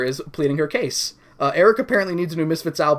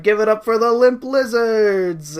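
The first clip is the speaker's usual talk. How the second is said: announcer voice; 6 semitones higher, 4 dB louder, slower than usual. Acted. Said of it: "it's like".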